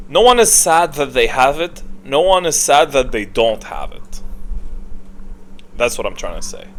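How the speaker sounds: usual speaking voice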